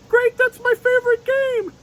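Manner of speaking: very high, chatty unicorn; cheerful little unicorn explaining; unicorn puppet voice. Pretend. Unicorn puppet answering the dragon, very high. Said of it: "she said".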